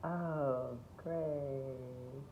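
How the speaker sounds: high, drawn-out mock delight; unimpressed auditioner. Auditioners hearing Eponine yet another time, feigned enthusiasm. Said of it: "the auditioners are like".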